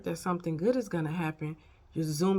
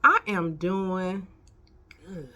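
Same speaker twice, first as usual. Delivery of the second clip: slow, drawn-out retold reply; emphatic answer; much slower than usual. Telling a friend how she is, slow and emphatic. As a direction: stretch every word out for effect. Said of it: "You know what I said?".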